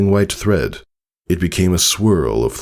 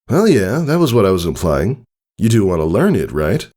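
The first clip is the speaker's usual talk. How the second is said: higher, quick, casual stallion voice; breezy, a bit boastful; friendly older stallion; 4 semitones higher than usual. Acted. Tycho telling Scootaloo about learning it, casually.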